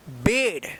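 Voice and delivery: बेड with a short e, said low and firm; short e sound. Shown as a demonstration. Teacher models बेड for the class with a short e. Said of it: "इस तरह से इसको उच्चारण करके बोलना है".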